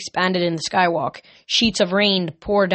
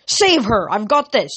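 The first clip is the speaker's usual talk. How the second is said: louder urgent shout; shouted order; 4 semitones higher, 4 dB louder than usual. Acted. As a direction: call it down while flying off. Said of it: "Coach Hedge shouted down".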